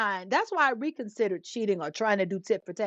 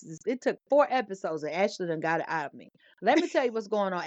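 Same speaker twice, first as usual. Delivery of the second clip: ordinary talk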